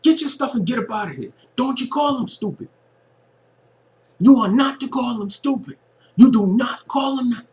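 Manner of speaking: high, loud hammed-up angry Forrest; snapping. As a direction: snap at her, telling her off in a high mock yell. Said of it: "And he was snapping".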